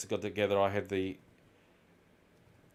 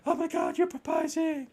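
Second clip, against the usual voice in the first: much higher, breathless mock girlfriend; gushing girlfriend. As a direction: gush it like she's overwhelmed. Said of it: "And she's just like".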